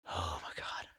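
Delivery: hushed, breath-only sigh, near tears; real, whispered fight against tears; close to crying. Real, not acted. Vlogger overcome talking about an idol, close to crying. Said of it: "I cry every time I think about it".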